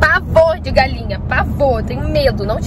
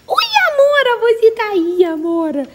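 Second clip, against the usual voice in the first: high bright hello to a pet; high pet greeting; 9 semitones higher than usual. Real, not acted. Owner greeting her mare in a high sing-song.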